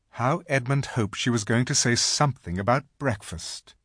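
plain reading voice

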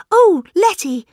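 high, loud fluttery exclamation; excited cry. A narrator's reading. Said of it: "cried Miss Bunner".

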